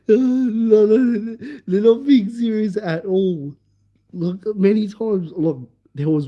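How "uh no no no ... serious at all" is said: high, laughing protest. Real, not acted. Storyteller explaining the friends are only joking, laughing. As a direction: insist through laughter, pitched high. Reassures while still laughing.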